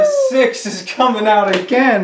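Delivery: high, loud, thrilled cry; excited. Real, not acted. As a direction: shout with real delight at the roll. Lucky dice roll.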